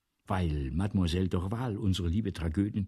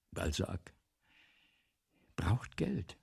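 whisper; 4 semitones lower, 4 dB quieter, slower than usual. Acted. Writer confesses need for money.

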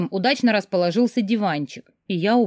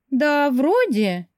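high, very slow, hesitant answer; unsure; 8 semitones higher, much slower than usual. A narrator's reading. Friend answers about her pregnancy, unsure. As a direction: drag it out as if not certain. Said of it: "неуверенно отозвалась подруга".

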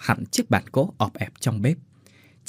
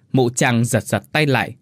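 ordinary delivery

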